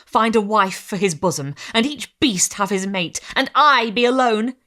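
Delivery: crying out. Acted. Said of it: "cried he".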